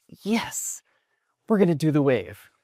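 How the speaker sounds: high, whispery cheer to oneself; excited but hushed, high-pitched; thrilled yes. Retold or imitated. Kid reacting to the Jumbotron, hushed excitement. Retold thrill at seeing the sign.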